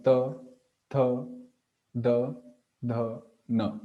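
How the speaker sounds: each of 'ta, tha, da, dha, na' dental and set apart; dental consonants. Shown as a demonstration. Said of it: "touches the upper teeth".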